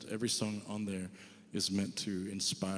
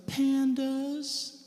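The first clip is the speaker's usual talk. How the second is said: imitating wife; 4 dB louder than usual. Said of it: "She's like".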